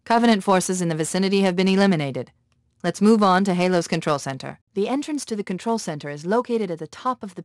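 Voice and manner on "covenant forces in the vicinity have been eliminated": ordinary talk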